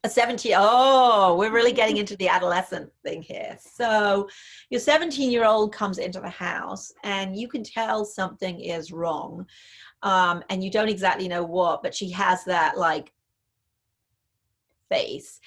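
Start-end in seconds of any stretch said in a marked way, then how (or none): none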